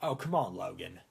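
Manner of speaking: low, soft impression of a film bad guy; film villain impression. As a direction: imitate his accent, low and trailing down. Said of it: "the guy talks like this or something".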